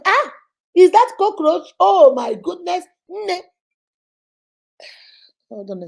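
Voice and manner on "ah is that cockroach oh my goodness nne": higher, louder mock shriek at a cockroach; hammed-up fright at a bug; mock panic. Husband panics over cockroach.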